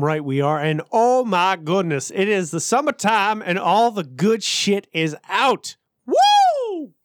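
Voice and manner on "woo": high, long "woo"; excited whoop. Real, not acted. Hyping the summertime releases.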